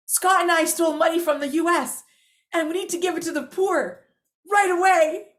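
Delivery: high, urgent announcement; acted urgency. Daughter reporting the theft to Mom, acted urgency.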